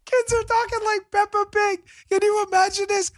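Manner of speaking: very high, incredulous squeal of talk. Real, not acted. Host reacting to kids' accents, high comic incredulity. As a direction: pitch it way up in comic disbelief. Mock astonishment at the story.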